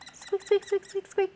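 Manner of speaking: high, soft mouse squeaks; playful high squeaks copying the marker; squeaky mouse. Pretend. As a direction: squeak along like a tiny mouse. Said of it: "Sounds like a weird mouse".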